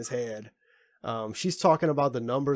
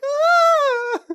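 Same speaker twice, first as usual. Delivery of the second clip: high, loud imitated yelp; mock scream; 8 dB louder than usual. Imitating the hero screaming at the omelet rice. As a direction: belt out a sudden shriek of joy. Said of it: "screaming at the omelet rice".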